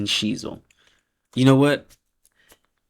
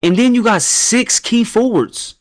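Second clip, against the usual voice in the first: high, slow astonished voice; incredulous; 10 semitones higher than usual. Real, not acted. Noticing the friend picked six key forwards, high slow disbelief. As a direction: stretch it out in disbelief.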